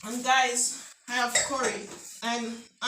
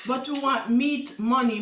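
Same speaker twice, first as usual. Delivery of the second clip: normal talking voice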